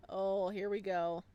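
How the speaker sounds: normal talking voice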